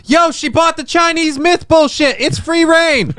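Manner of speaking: high squeaky bug voice; cricket voice. Pretend. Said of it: "a cricket out front".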